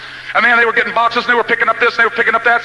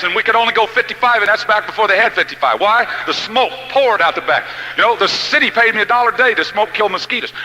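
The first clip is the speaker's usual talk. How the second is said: usual speaking voice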